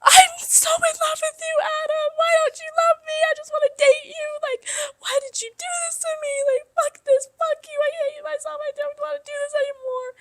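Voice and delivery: hammed-up high-pitched wailing; mock sobbing, very high, as a drunken meltdown; screaming crying. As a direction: wail it like an over-the-top heartbroken drunk. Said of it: "screaming, crying".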